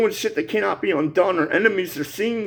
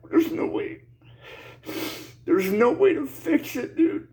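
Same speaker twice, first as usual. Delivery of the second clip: real tearful voice, higher pitched, breaking off; crying; 4 semitones higher than usual. Real, not acted. Man lamenting the line of no return, crying. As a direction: choke out the words through tears, halting. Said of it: "I'm crying like a bitch".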